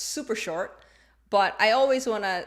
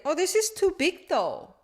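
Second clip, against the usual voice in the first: higher-pitched dismayed exclamation; surprised complaint; 8 semitones higher, 3 dB louder than usual. Acted. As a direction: act out dismay at a poor fit. Acting out complaint while trying clothes.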